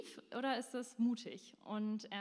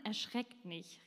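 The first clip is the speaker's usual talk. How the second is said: normal talking voice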